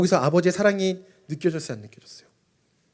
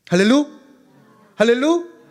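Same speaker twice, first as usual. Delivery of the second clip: loud high call, leaving the word open for the crowd to finish; call and response; 9 semitones higher, 7 dB louder than usual. Real, not acted. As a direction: call out to the congregation and wait. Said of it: "calls for congregation's response". (회중에게 크게 외치는 할렐루)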